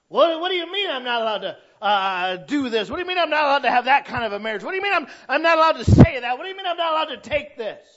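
loud, high, fast outraged rant; indignant protest. Acted. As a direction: protest in a hammed-up, indignant shout. Voices people's indignation at God's rules.

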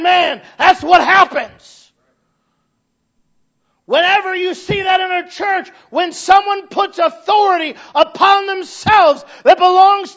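loud, high, slow preaching; preaching shout. Acted. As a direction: thunder it from the pulpit.

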